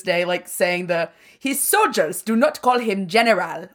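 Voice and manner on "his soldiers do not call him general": mock Namor accent. Quoting the Namor line to cohost, hammed-up mock accent. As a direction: drag out Kukulkan in a hammed-up accent. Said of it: "a terrible accent".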